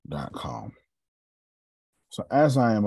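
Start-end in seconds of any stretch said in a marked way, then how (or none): 0.1-0.7 soft whisper. Real, not acted. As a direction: whisper it under breath. Whispered aside while typing URL.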